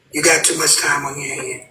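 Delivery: ordinary talk, nothing marked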